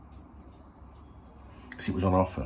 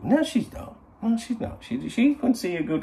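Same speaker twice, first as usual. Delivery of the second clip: indignant denial. Real, not acted. Defending his girlfriend.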